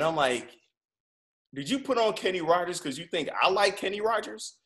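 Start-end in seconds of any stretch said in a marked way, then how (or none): 1.5-4.6 incredulous question. Retold or imitated. Quoting own question to driver.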